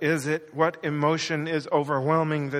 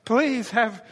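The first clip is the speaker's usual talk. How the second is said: higher, urgent pleading outburst; blurted plea; 5 semitones higher, 4 dB louder than usual. Acted. Desperate father begging Jesus for help, blurting it out. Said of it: "he just blurts out".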